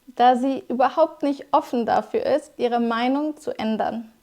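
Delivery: ordinary delivery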